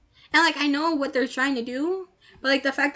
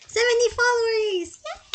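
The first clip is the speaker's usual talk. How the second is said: high, slow excited cheer; 10 semitones higher, 4 dB louder than usual. Real, not acted. Celebrating a follower milestone.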